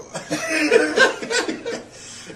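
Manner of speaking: high cackling laugh; real high-pitched peal of laughter; loud laugh. Real, not acted. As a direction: burst out laughing after the sign-off. Laughs after his sign-off.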